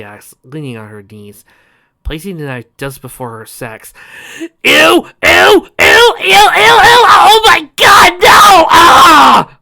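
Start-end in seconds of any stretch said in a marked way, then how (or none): 4.4-9.6 loud, high disgusted shrieks; disgusted screaming. Real, not acted. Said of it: "narrator reacts in disgust".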